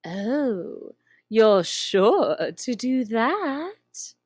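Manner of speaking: slow, purring Cheshire Cat voice; slow drawling cat. Acted. Grinning cat teasing the lost girl, slow and drawn out. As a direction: stretch each word like a lazy cat.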